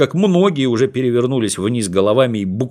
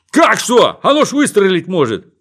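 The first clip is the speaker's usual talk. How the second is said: panicked; 9 semitones higher, 5 dB louder than usual. Acted. Policeman panics about the rifle.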